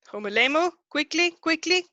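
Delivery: high, loud, urgent call; hurrying a student. Real, not acted. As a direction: call out to hurry them along. Teacher hurrying a student along.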